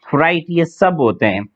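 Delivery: usual speaking voice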